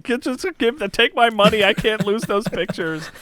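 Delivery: high, loud pleading rush; desperate plea. Retold or imitated. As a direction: beg them to take your money. New dad reacting to ad.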